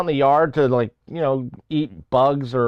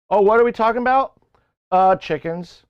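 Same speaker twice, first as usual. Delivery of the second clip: hyped mode; 6 semitones higher than usual. Acted. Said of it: "I was still in that mode".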